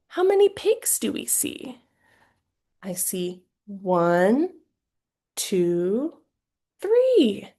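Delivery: sing-song counting, pitch jumping up on the last number; asking a child. Shown as a demonstration. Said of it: "if I was really asking the question to a child".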